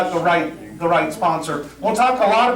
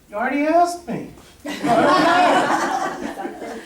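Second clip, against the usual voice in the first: much higher, laughing bewildered reply; clueless Pete; 8 semitones higher, slower than usual. Retold or imitated. A castmate taking the cue as a question, higher laughing confusion. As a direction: play him cheerfully confused.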